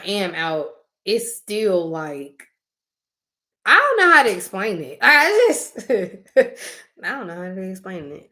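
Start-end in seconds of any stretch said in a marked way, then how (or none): 5.0-8.3 giggling through the words; real amused giggle, slowed down; laughing. Real, not acted. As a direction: laugh while you try to explain. Laughing at her own struggle to explain.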